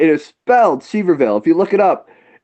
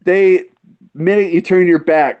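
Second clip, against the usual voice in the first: normal talking voice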